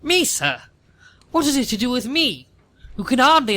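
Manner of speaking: high, affronted outrage; haughty indignation. Acted. Lady Bracknell told a handbag should satisfy her, high affronted outrage. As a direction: rise up in offended hauteur.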